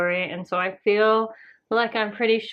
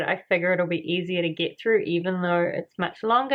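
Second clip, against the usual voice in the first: normal talking voice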